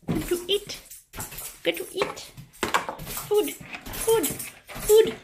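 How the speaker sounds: high sing-song talk to the dog; pet voice. Real, not acted. Talking to her dog in a high pet voice. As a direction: coo up high like to a puppy.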